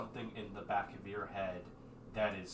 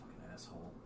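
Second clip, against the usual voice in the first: much softer, whispered aside; muttered insult; 4 semitones lower, 11 dB quieter, faster than usual. Acted. Stranger insulting the absent companion, muttering. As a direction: curse under the breath.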